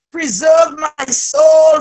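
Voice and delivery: ordinary delivery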